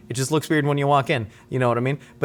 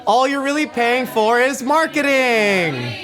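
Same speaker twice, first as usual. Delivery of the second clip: much higher, slow, booming TV announcer read; game-show announcer; 11 semitones higher, 3 dB louder, much slower than usual. Acted. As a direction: sell it like a grand game-show host. Show intro hype announcer.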